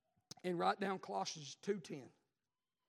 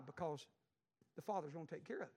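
soft reassurance; 6 semitones lower, 5 dB quieter than usual. Acted. Soft reassurance after build.

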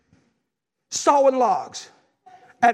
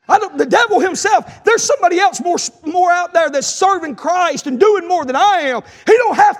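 loud, high shouted preaching; preacher crying out to the congregation about the devil, loud preaching; preaching at full force; 5 semitones higher, 5 dB louder than usual. Acted. Preacher to the church, shouting it out.

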